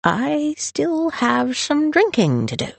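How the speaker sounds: slow, high, woozy drawl; tipsy, stretched-out words; slurred, drunk. A narrator's reading. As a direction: announce it unsteadily, clinging to the glass. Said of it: "the slur in his voice".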